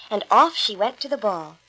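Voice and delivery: usual speaking voice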